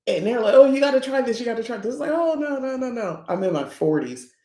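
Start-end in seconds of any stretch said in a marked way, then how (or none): 0.5-1.9 high-pitched egging-on quote; eager urging. Retold or imitated. Party crowd pressing a drink on someone, eager urging. 2.1-3.2 high, sing-out string of refusals; acted-out protest in a high voice; playful refusal. Woman in her forties turning down party drinks, playful high refusal.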